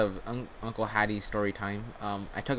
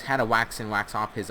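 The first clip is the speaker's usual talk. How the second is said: ordinary talk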